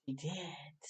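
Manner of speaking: ordinary delivery, nothing marked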